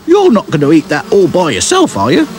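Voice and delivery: very high, loud demanding wasp. A narrator's reading. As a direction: demand it like a pushy insect. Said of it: "demanded a third wasp".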